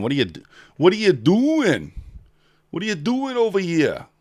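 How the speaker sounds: high, loud put-on Boston accent. Streamer teasing late chat in a Boston accent. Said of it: "That's my best, uh, kind of Boston accent".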